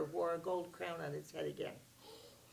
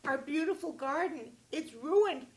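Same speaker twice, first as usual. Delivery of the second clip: upset daughter; 9 semitones higher, 6 dB louder than usual. Acted. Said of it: "And she said".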